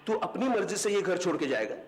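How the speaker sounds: high-pitched, mounting outrage; angry confrontation. Acted. Quarrel with tenant escalates. (गुस्से में चढ़ती ऊँची आवाज़)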